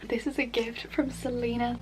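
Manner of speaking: ordinary talk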